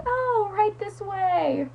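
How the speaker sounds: high, slow, drawn-out put-on politeness; mock usher lady. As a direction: imitate a lady ushering someone through. Acting out lady ushering her in.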